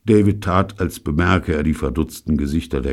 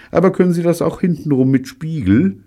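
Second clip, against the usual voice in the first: David challenging; 13 semitones higher, 6 dB louder than usual. Acted.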